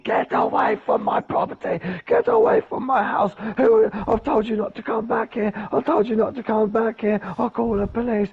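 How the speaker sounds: put-on very high, strained old man's rant; panicky, high-pitched old-man voice; old man freaking out. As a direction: impersonate a frantic old man ordering someone off. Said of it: "This guy was freaking out".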